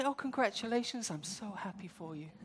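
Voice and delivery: soft, polite on the surface; a hint of forced sweetness; fake politeness. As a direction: congratulate through clenched teeth, softly. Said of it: "outwardly you say".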